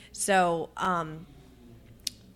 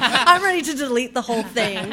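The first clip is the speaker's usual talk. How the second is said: louder, half-laughing mock despair; laughing exasperation; 5 semitones higher, 10 dB louder than usual. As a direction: blurt it out through a laugh, fed up. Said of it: "I'm like".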